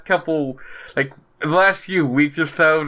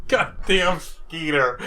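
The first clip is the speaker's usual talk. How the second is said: laughing; 4 dB quieter, slower than usual. Real, not acted. Laughing at comic panel.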